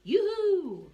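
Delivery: high, loud calling yoo-hoo; a high cheerful hail; sing-song yoo-hoo. Shown as a demonstration. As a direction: call out yoo-hoo as if to a friend. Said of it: "like yes and yellow".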